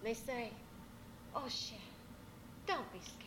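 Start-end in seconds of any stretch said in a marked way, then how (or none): 1.3-3.2 put-on soft, hushed comfort to a baby; gently calm a scared child; tender, quiet reassurance; soothing mama. Mamas soothe babies on their laps.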